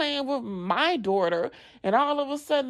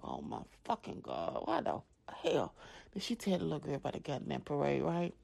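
whispered inner curse; 8 semitones lower, 12 dB quieter, faster than usual. Retold or imitated. Inner exasperated thought, whispered.

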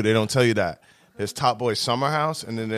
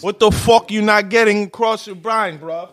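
put-on London street accent, pitched up and pushy; London dealer accent; 8 semitones higher, 3 dB louder than usual. Joking with friends as a London dealer, pitched-up London accent. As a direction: throw it at them like a London road man. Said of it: "talking like a London drug dealer".

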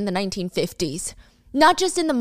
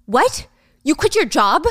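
angry; 4 semitones higher, 3 dB louder than usual. Retold or imitated. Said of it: "Gia, for the first time, is angry".

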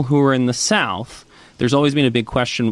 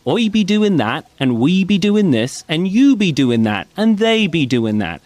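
West Country dialect; 6 semitones higher than usual. Retold or imitated. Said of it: "but they do say things like".

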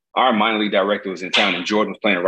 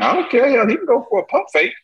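cocky brag; 14 semitones higher than usual. Retold or imitated. Teller's cocky retort voiced.

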